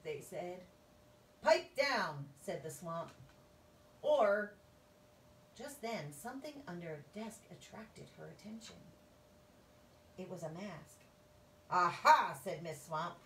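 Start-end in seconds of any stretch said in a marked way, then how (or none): 1.4-2.3 stern shout. Acted. Witch scolds class. 11.7-12.4 loud aha. Acted. Witch discovers mask.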